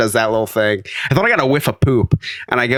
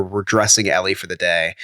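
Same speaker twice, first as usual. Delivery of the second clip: usual speaking voice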